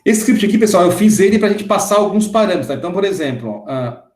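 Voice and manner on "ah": usual speaking voice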